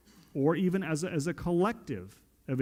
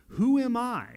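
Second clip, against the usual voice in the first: raised high and loud; ringing rhetorical question; 8 semitones higher, 4 dB louder than usual. Retold or imitated. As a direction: throw the question out to the room. Quoting the self-question.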